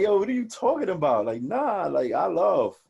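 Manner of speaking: high, loud, offended disbelief; indignant protest. Retold or imitated. To friends who call him unemotional, an indignant high protest. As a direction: push back as if insulted. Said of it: "And I'll be like".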